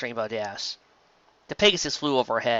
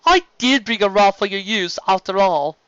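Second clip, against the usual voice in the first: Stephen's voice; 7 semitones higher, 6 dB louder than usual. Acted. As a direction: voice Stephen as a hesitant servant.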